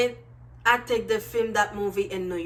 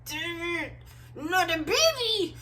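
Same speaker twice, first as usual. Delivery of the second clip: high, hushed fake despair; mock dismay; 7 semitones higher, 4 dB quieter than usual. Viewer reacting to the pregnancy twist, hushed mock despair. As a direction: groan at the plot twist.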